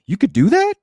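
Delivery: hammed-up eager disbelief; surprised delight. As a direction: jump at the free offer. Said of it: "He's like".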